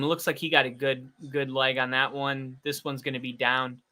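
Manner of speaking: ordinary talk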